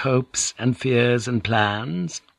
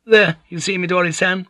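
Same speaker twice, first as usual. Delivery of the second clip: impatient interruption; 10 semitones higher than usual. Acted. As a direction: snap in over Midori, impatient. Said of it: "the old woman interrupted impatiently".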